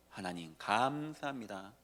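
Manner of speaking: soft, slow whispered-down thanks; quiet thanks. Retold or imitated. Preacher voicing a believer's prayer that satan fears, quiet and slow. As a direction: murmur it as a humble prayer. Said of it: "사단이 가장 두려워하는 거".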